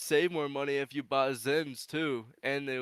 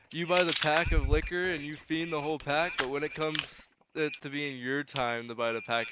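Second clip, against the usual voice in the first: ordinary delivery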